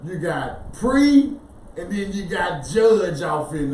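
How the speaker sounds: "pre" and "judge" split apart, each stretched and punched; word broken down. Shown as a demonstration. Teacher breaking a word down for listeners, pieces stressed separately. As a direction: lean hard on "pre", then on "judge". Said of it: "Break the word down".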